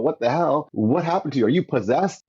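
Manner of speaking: lightly voiced friend backing away; alarmed friend. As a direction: ask like he's spooked.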